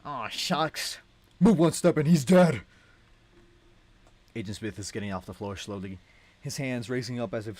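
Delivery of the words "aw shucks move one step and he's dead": loud, higher villain threat; hostage threat. Acted. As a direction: threaten the room, sword at his neck.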